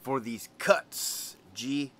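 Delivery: slow, drawn-out imitation of a buddy; lightly mimicked slow delivery; Greñas impression. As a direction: stretch out the boast, soft G at the end. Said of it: "He kinda talked like this".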